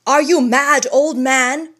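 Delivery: high, loud, harsh challenge; loud harsh demand. Acted. Governor confronting the old man, loud and harsh. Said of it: "in loud and harsh tones".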